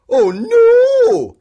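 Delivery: high loud cry of alarm; big dramatic oh no; cried out. A narrator's reading. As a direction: shriek it in dismay. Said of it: "he cried".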